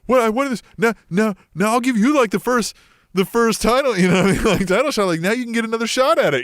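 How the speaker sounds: high, loud, insistent role-play; worked-up mock wrestler talk; acted wrestler's plea. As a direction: play the wrestler arguing his case. Acting out a wrestler's speech.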